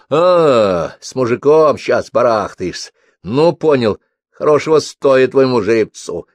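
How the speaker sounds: a knowing drawn-out realisation, then hearty teasing; teasing caller Mikhalych. A narrator's reading. Caller teasing the narrator about a lover, loud knowing tone.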